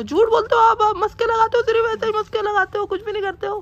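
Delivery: high falsetto wife scolding; mimicking wife. Husband mimics his wife accusing him, high falsetto. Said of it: "mimicry कर रहा हूँ मैं".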